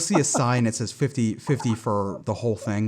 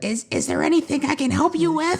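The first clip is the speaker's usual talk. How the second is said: high granny voice breaking into laughter; old lady voice. Acted. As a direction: play the frail old shopkeeper. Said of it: "I wanted to hear her say it".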